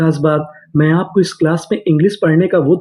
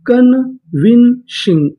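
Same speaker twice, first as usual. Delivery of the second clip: Convincing stretched out slowly, syllable by syllable; slow drawn-out word; 3 semitones higher, much slower than usual. Shown as a demonstration. Teacher to students, drawing convincing out slowly. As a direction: draw out convincing slowly for the class. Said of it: "पूरी meaning".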